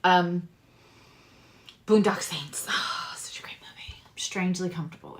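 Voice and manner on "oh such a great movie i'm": soft breathy whisper of fondness; whispered aside. Real, not acted. Reader gushing about a favourite film in a whisper. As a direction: breathe it out like a private confession.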